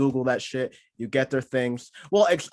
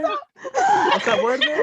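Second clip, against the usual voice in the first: mock audition; 6 dB louder, much slower than usual. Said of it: "I'll give you a little snippet".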